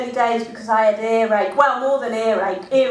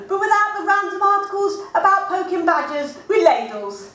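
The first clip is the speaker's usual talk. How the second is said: ordinary talk, nothing marked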